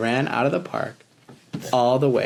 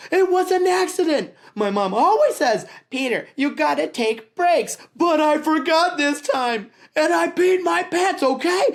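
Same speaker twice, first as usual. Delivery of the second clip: put-on upset boy's outburst; 3 dB louder than usual. A narrator's reading. Peter confessing to his staring friends, high and upset. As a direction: hold the high hurt boy voice all through.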